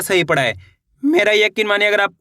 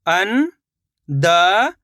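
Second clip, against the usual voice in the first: अंदर stretched very slowly, rising at the end; slow drawn-out reading; much slower than usual. Shown as a demonstration. Teacher sounding out अंदर for pupils, very slow and rising. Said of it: "शब्द को आपको खुद से पढ़ना है".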